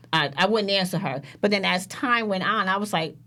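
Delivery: ordinary delivery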